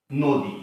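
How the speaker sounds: নদী with an o sound in the first syllable, নোদী. Shown as a demonstration. Teacher showing students the spoken form, clear demo. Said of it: "এটাই উচ্চারণ".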